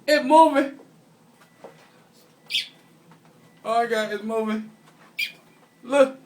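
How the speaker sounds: high panicked squeals at the bird; freaking out, voice shooting up; scared screaming. Real, not acted. Panicked by a moving baby bird.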